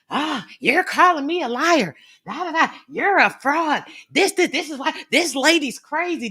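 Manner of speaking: high, loud mocking tantrum; mock angry kid. Woman mimicking the man's rant at her, like an angry kid. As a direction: imitate a little kid's indignant rant. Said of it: "Sounded like one of my kids getting angry".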